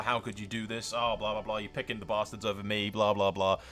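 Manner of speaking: ordinary talk, nothing marked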